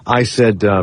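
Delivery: ordinary delivery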